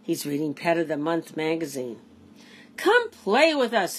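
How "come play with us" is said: pets calling. A narrator's reading. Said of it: "his lonely pets chirped and barked and purred".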